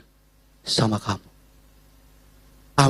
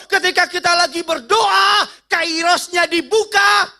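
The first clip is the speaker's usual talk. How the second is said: high, loud sermon build; full-force preaching; 13 semitones higher, 3 dB louder, slower than usual. Acted.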